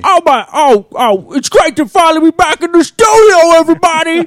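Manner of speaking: very high, wailing comic impression; Tracy Morgan. Comedian back in the studio greeting everybody, high exuberant impression. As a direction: put on a high, exclaiming voice and stretch it out. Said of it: "Tracy Morgan is also another one".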